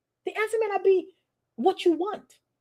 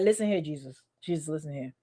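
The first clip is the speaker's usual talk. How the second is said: hushed firm scolding; 7 semitones lower, 7 dB quieter than usual. Retold or imitated. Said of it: "I'll be like".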